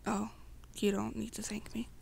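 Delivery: plain reading voice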